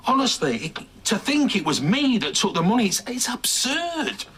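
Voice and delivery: high, loud, indignant protest; outraged and spluttering, pitched high; exasperated denial. Acted. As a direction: protest the accusation with rising exasperation. Denies the theft, exasperated.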